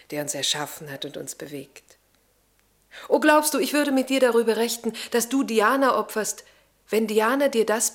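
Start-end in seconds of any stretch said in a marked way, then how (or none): 0.1-2.0 hushed reverence. Acted. Religious devotion, hushed ending. 3.1-5.4 impassioned cry. Acted. Lavinia's passionate outburst.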